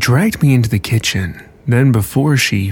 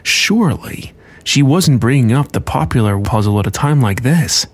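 plain reading voice

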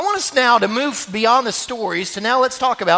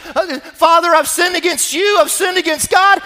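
high, loud pleading confession; pleading son; 8 semitones higher, 4 dB louder than usual. Acted. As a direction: cry out the apology to your father. Son's memorized speech, rising.

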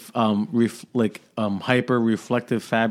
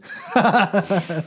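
real laugh; 8 semitones higher than usual. Real, not acted. Laughing at own joke.

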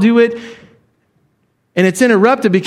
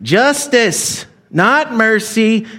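mock stern moralizer; much slower than usual. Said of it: "the older son is a Republican".

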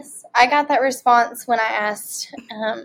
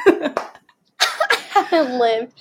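laughing; 3 semitones higher, much slower than usual. Real, not acted. Repeats friend's line while laughing.

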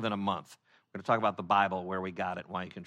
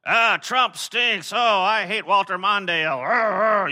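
high, loud, slow mock complaint; mock grumbling American; 7 semitones higher, 8 dB louder, slower than usual. Preacher mimics Americans griping freely, high and loud. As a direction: bellow a silly gripe. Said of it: "you could go".

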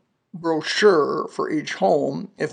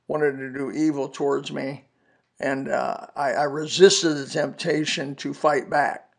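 normal talking voice

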